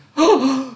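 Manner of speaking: high, loud theatrical gasp; mock gasp. Host gasping at players, hammed-up. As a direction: gasp in pretend shock.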